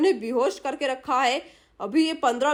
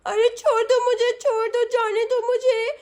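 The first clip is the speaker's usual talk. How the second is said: put-on high, childish lisping voice; protesting little girl; 7 semitones higher than usual. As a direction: plead and scold like a small girl held captive.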